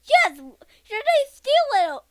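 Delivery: high, loud storybook outcry; acted outburst. Boy voicing a character accused of stealing, loud outburst. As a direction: cry it out high and loud, drawing out the words. Child acts out the fearful reply.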